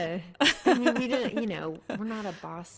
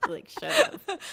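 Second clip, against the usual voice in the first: laughing through the words; 7 dB louder, slower than usual. Real, not acted. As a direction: laugh it out, slow.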